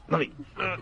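hushed mock effort to cry; mock straining. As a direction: squeeze the words out like forcing a tear. Pretending to force a tear.

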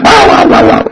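mock yelps of a hit dog; playful dog yelp imitation; imitated dog yelping. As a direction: yip like a dog that got hit. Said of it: "he goes".

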